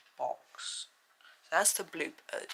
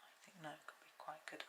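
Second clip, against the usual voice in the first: whisper; 21 dB quieter than usual. Real, not acted. Whispered aside while painting.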